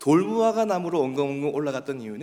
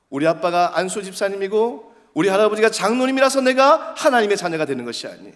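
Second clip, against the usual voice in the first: raised, high-pitched sermon delivery; emphatic preaching; 8 semitones higher, 6 dB louder than usual. Real, not acted. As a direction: press the point on the congregation.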